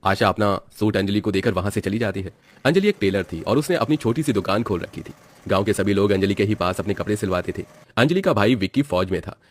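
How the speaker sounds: plain reading voice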